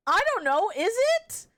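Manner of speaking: ordinary delivery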